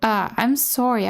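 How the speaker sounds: ordinary talk, nothing marked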